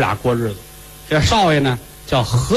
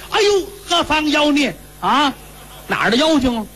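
startled shout; 10 semitones higher than usual. Acted. Father startled, sees a monster.

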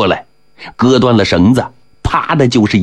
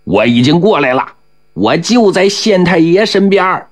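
high-pitched answering yell; narrated holler, rising in pitch; sudden shout; 10 semitones higher than usual. A narrator's reading. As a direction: yell back eagerly from close by. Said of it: "突然近在身边的有人喊着".

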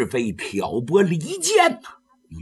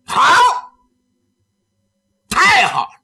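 loud bitter approval; 12 semitones higher, 6 dB louder than usual. Acted. Taoist answers her vow.

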